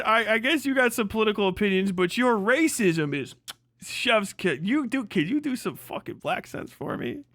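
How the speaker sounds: usual speaking voice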